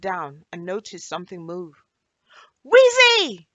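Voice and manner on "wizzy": very high, loud scream of her name. Acted. Anna screaming in alarm as she spots her melting friend. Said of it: "she screamed".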